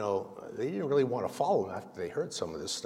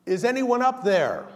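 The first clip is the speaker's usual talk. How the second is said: loud, high call from below; yelling up; 10 semitones higher, 7 dB louder than usual. Acted. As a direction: shout it up the cliff like he's hanging there. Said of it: "he yells out".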